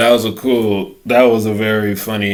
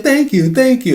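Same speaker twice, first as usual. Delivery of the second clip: high, quick mock-modest thank you; sheepish thanks; 4 dB louder than usual. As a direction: play the humble winner, pitched up. Accepting praise for fake Emmy.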